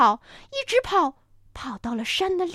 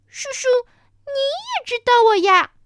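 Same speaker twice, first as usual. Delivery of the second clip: put-on little child voice; 11 semitones higher, 6 dB louder than usual. Baby dinosaur talks to stranger.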